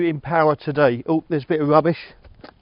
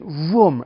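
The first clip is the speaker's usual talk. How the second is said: louder mock motor vroom; imitated epilator buzz; 6 dB louder than usual. Husband telling how his wife used the epilator, imitated buzz. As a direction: imitate an epilator suddenly revving up. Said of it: "She just went".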